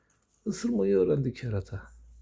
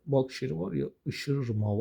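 ordinary talk, nothing marked

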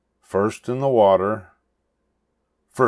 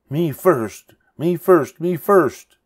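mocking imitation; 9 semitones higher, 5 dB louder, slower than usual. A narrator's reading. Witch mimics Pinkerton.

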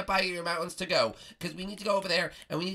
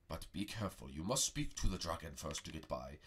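wise master voice; 12 dB quieter than usual. Acted. Master Prego warns.

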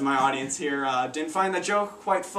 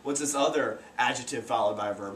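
ordinary talk

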